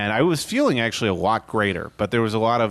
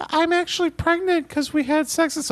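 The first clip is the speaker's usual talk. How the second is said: high-pitched woman's voice; high Mary voice. Acted. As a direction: squeak it out as Mary. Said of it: "Mary comes to him like".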